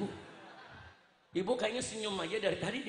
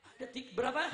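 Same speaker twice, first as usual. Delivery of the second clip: ordinary talk, nothing marked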